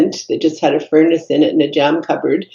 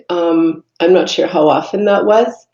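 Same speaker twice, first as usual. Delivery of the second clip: ordinary talk, nothing marked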